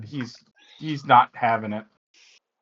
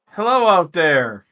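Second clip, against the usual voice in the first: loud, higher hail into the dark; calling out; 5 semitones higher, 9 dB louder than usual. Acted. Player's druid hails the creatures in the dark, calling out. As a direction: call out to whoever is out there. Character calls out to unseen creatures.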